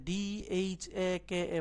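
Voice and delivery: ডি এইচ এ কে এ spelled slowly, each letter apart; slow letter-by-letter spelling of ডি এইচ এ কে এ. Shown as a demonstration. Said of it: "তখন সে কিন্তু বলে দেয়".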